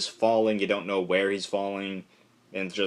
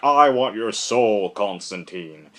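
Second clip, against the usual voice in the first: slow, loud demand, then dropping off; hammed-up tantrum of a spirit; mock hissy fit; 6 dB louder, slower than usual. Spectre demanding Constantine's soul in a slow mock tantrum. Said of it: "he's throwing a hissy fit".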